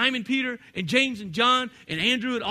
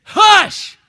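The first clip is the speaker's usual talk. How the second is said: sharp, very loud high shout; Jesus shouting down the storm; commanding shout; 13 semitones higher, 18 dB louder than usual. Acted. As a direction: snap the storm silent. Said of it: "He says".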